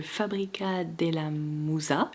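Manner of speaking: hammed-up Italian accent; thick Italian accent. Shown as a demonstration. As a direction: roll Fabrica della Musa out like an Italian, drawn out. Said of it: "avec mon accent italien à couper au couteau".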